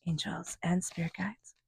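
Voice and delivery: soft, slow whisper; hushed invocation. Real, not acted. Reader calling on angels and spirit guides, hushed invocation. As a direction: whisper it like a short prayer.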